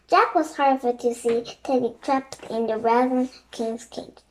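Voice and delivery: ordinary delivery, nothing marked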